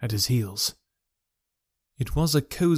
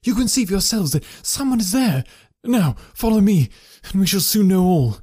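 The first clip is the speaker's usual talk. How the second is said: higher and louder, pressing forward; urgent husband; 7 semitones higher, 4 dB louder than usual. A narrator's reading. Husband urging Holmes to follow him, higher and louder. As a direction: hurry them on, eager and tense. Husband urges friends onward.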